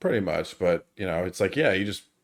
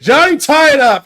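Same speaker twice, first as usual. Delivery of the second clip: loud, high call across the street; sudden high shouted hail; Doc Holliday calling out; 12 semitones higher, 8 dB louder than usual. Retold or imitated. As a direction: shout it out to get his attention. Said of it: "hear Doc Holliday go".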